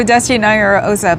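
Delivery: normal talking voice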